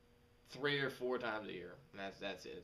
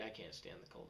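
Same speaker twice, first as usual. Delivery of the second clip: muttered complaint; 7 semitones lower, 14 dB quieter, faster than usual. Real, not acted. Muttered complaint about cold.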